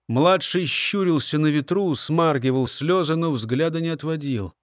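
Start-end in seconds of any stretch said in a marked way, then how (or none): none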